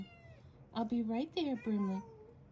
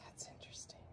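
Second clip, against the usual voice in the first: soft unvoiced whisper; 15 dB quieter, much faster than usual. Real, not acted. Whispering an update on the kittens to viewers. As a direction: keep it hushed.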